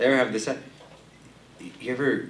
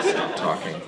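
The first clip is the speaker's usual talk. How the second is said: ordinary talk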